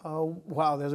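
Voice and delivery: normal talking voice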